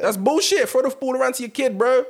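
high, loud outburst of disbelief; heated protest. Real, not acted. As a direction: object loudly, pitched right up. Heated disagreement over Brady playing on.

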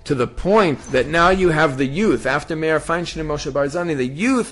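rising to a loud, high preaching climax; rousing preaching. Acted. Preacher stirring the congregation to action, building loud and high. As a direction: build the fervour line by line until you're calling out.

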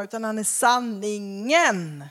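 "sanningen" slowed and weighted; "sanningen" drawn out slowly; stress on sanningen. Shown as a demonstration. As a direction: lean into "sanningen". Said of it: "inte att han är en sanning".

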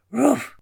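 one sharp high loud bark; a small dog barking out its answer to the roll call; high yappy woof, loud. Acted.

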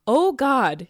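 ordinary talk, nothing marked

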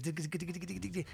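tiny rapid dig-dig-dig, very soft; imitated violin scratching. Violinist trying out the image, imitated soft sawing. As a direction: imitate a timid fiddle sawing, under your breath.

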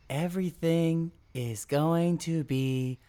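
slow, high comforting toy voice; drawn-out soothing robot; robotic toy voice. Retold or imitated. Therapy buddy toy voice.

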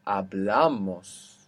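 "hablamos" drawn out slowly, stressed on BLA; penultimate stress. Shown as a demonstration. Teacher showing stress after adding syllables, slow "hablamos" leaning on BLA. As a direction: stretch the second last syllable of "hablamos". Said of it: "it's still on the second last".

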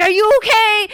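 high, loud mock-alarmed shout; retold freaking out. Dad checking on his kid after the scare, high alarmed shout. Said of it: "everyone was freaking out".

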